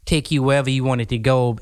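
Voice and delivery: ordinary delivery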